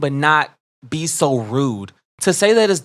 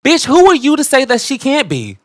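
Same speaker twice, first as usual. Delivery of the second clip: much higher and louder, building outrage; heated indignation; 9 semitones higher, 5 dB louder than usual. Real, not acted. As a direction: challenge him fiercely. Heated rant at commenter.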